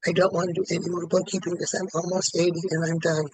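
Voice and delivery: ordinary delivery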